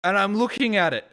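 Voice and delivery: angry ranting narration; real angry rant. Real, not acted. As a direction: tell it furious, still seething. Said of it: "I'm very angry".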